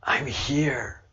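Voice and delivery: whispered, weak and frail. Retold or imitated. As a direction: breathe it like a sick woman barely there. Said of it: "She's, like, frail and weak".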